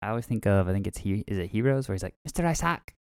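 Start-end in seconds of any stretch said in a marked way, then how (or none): 2.2-2.9 high, put-on foreign accent for "Mister Isaac"; cheesy TV-show accent; terrible accent. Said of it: "with your terrible accent".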